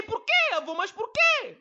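put-on very high, loud child asking why; curious child. Grandson begging grandpa to explain why, high and loud. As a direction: pester grandpa like a little boy.

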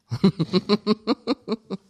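high staccato chuckle; loud rapid-fire laugh at own joke. Real, not acted. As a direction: burst into a bubbly, high laugh. Jokes about porn's quick ending.

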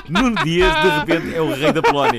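laughing hard, high and loud through the words. Real, not acted. Teasing a friend about his fourteen matches, laughing loudly. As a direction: crack up while crowning your friend.

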